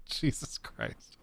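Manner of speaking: half-whispered, slow, breathy with laughter; breathless laughing mutter. Real, not acted. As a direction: sigh it out while still laughing. Laughing to himself at chat.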